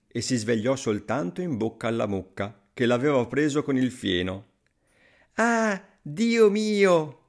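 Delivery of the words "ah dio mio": high, long drawn-out wail of alarm; alarmed storybook exclamation; crying out. A narrator's reading. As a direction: cry out in slow dismay. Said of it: "gridò".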